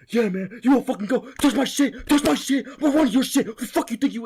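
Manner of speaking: loud, high-pitched mock shouting; imitated trash talk. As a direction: act out fighters yelling threats. Fighters talking trash, retold.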